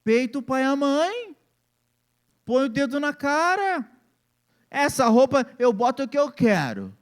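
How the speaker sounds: mock the rebellious teen who defies his parents; defiant teenager. Preacher imitating a teen defying his parents, mildly mocking. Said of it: "você diz assim".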